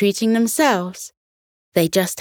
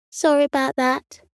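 shy cat voice; 7 semitones higher than usual. Acted. Cat apologizes at the door.

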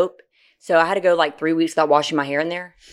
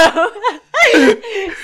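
high, louder laughter; a higher, louder laugh that keeps on; laugh burst; 14 semitones higher, 5 dB louder than usual. Real, not acted. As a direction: laugh it out again. Cracking up at own story.

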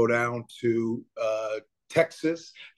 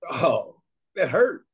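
usual speaking voice

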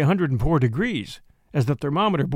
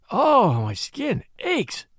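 slow, drawn-out moan of pain; moaning plea; 5 semitones higher, much slower than usual. Acted. Hummel to Spurstow, moaning slowly in misery. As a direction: stretch it out like a long ache.

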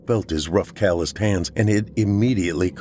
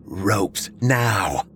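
commanding. A narrator's reading. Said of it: "Hannah commanded".

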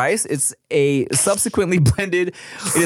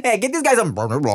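put-on fast Thai-accented call; 6 semitones higher, much faster than usual. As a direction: order vanilla like a Thai cousin. Voicing the cousins.

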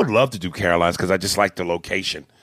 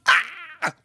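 put-on child's scared scream.

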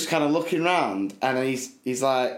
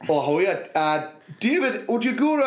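Geordie teacher; 6 semitones higher than usual. Retold or imitated. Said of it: "Geordie P E teacher said".

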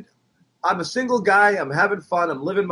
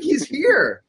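high, thrilled announcement; starstruck excitement; 10 semitones higher than usual. Retold or imitated. Fan telling his girlfriend the player is here, high and thrilled. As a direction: blurt it out like you can't believe it. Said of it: "I was like".

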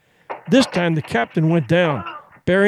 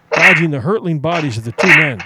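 ordinary delivery, nothing marked

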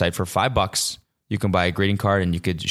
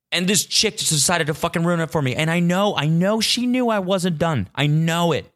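higher, insistent indignant rant; pissed off; 8 semitones higher than usual. Real, not acted. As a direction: accuse with rising exasperation. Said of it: "I'm getting pissed off".

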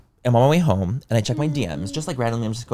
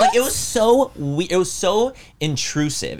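slow, pitched-up, stretched-out disbelief; 7 semitones higher, slower than usual. Real, not acted. Telling friends about the intrusive guy, slow and incredulous. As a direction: drag it out in shock. Reacting to the guy's behaviour.

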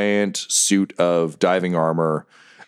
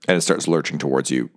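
ordinary delivery

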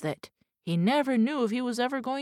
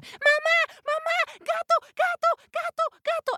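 put-on very high squeaky cries of terrified baby mice; panicked squeaky mice; 6 dB louder than usual. Little mice screaming again.

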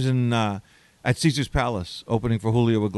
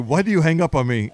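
higher, louder mock Spanish accent; 4 semitones higher, 6 dB louder than usual. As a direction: protest down the phone in an imitated Spanish accent. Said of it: "Tony, your accent stinks".

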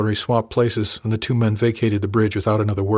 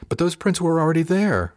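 objecting; 8 semitones higher than usual. A narrator's reading. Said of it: "I objected".

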